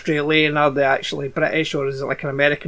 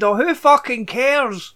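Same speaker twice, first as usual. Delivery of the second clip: raised-pitch indifferent retort; exasperated outburst; 6 semitones higher than usual. Retold or imitated. Viewer reacting aloud to divorce subplot, exasperated. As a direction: retell it as a fed-up outburst. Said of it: "genuinely out loud said".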